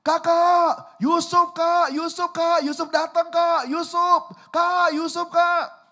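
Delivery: put-on high, loud yell to far-off older brothers; excited calling shout. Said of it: "Yusuf teriak".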